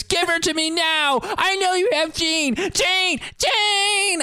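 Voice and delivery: very high, drawn-out mock scream; hammed-up desperate holler; Cyclops. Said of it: "so Cyclops screams".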